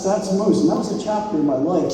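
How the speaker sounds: usual speaking voice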